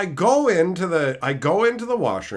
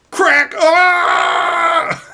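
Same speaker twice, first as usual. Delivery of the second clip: crack and scream; 9 dB louder than usual. Retold or imitated. Neck snapping, scream.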